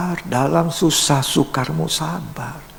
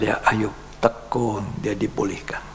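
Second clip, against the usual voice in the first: usual speaking voice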